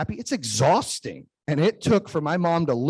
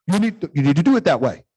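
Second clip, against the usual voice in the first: louder, insistent fatherly order; bossy dad; 6 dB louder than usual. Retold or imitated. Dad insisting his daughter do it his way, loud and bossy. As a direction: lay down the law like a stubborn dad.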